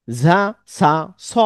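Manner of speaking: "ঝা সা স" sounded slowly with the tongue tip; slow letter demo. Shown as a demonstration. Teacher shows students a letter group by where it is made, slowly. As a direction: draw each letter out to show where it is made. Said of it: "জিহ্বার আগা ... লেগে".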